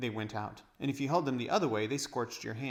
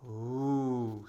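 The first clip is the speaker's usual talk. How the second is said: awed ooh; 4 dB louder than usual. Acted. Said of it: "said all the children at once".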